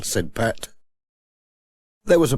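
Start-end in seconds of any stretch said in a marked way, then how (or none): none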